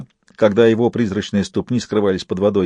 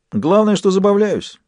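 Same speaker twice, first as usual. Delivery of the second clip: cheeky; 10 semitones higher than usual. A narrator's reading. Jester's cheeky retort.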